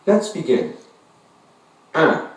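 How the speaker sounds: ordinary talk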